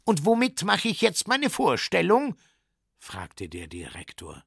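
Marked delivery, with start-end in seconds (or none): 0.0-2.4 high, agitated question; high-pitched exasperated demand; indignant circus director. Acted. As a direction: challenge him in a high, raised voice. Circus director challenges the bear.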